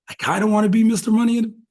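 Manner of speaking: high, fast mock daydream; wistful wish. Wrestler eyeing Money in the Bank, high fast wishful aside. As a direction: rush it out high, half dreaming. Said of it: "you're looking like".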